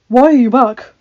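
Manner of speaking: loud, sharp demand; sharp question. A narrator's reading. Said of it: "Adam asked sharply".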